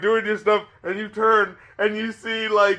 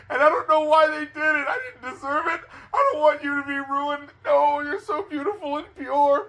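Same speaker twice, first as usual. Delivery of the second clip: mock tearful plea; 6 semitones higher than usual. Voicing a ruined adult to children.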